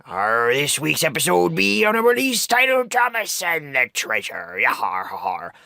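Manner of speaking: gruff seafaring pirate, hammed up; raspy pirate voice. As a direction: growl it out like a jolly pirate captain. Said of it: "like my raspy pirate voice just said".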